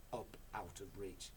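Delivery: usual speaking voice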